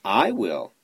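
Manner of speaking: ordinary delivery, nothing marked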